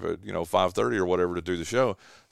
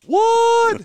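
high, loud startled yelp; startled what; 8 dB louder than usual. Retold or imitated. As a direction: jolt awake in alarm. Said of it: "And I'm like".

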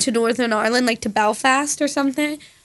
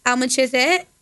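slurred regional accent with dropped H; regional accent demo. Shown as a demonstration. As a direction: say "How much is it" in an accent, dropping the H. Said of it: "some other accent is".

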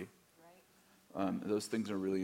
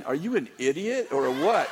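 louder, laughing mock thought; laughing inner insult; 6 semitones higher, 9 dB louder than usual. Pastor voicing his blunt inner thought with a laugh. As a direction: toss it off with a laugh. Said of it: "I'm thinking".

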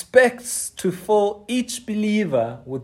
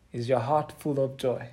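softer repeat; 4 semitones lower, 6 dB quieter than usual. Real, not acted. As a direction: lower and quieter, leaning on heart.

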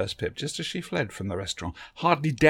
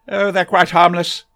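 put-on higher and louder, fussy old gentleman; eccentric Doctor voice; 8 semitones higher, 13 dB louder than usual. A narrator's reading. The Doctor greets Barbara from behind, a higher, louder fussy old gentleman. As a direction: bustle in as a fussy, kindly eccentric.

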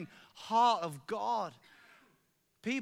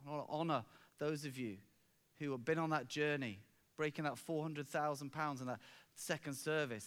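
ordinary talk, nothing marked

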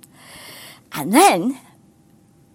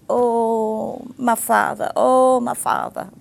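lament; 3 semitones higher, slower than usual. Retold or imitated. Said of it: "All he can say is".

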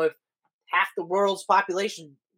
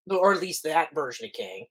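ordinary talk, nothing marked

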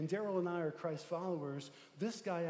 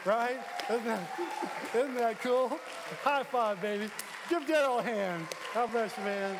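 rallying shout; 5 semitones higher, 7 dB louder than usual. Real, not acted. Rallying the congregation.

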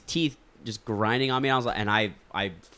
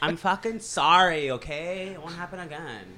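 put-on obnoxious girl voice; 8 semitones higher, 3 dB louder than usual. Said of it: "not that obnoxious".

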